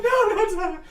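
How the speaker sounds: high, loud wail. Acted. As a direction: wail in dread. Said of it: "They're like".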